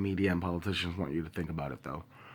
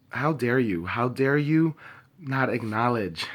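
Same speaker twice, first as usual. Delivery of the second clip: rising furious demand, louder on the repeat; angry; 3 semitones higher, 5 dB louder than usual. Real, not acted. At the media ignoring her community, furious demand. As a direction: throw it at them, voice swelling. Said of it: "I'm angry, I'm pissed".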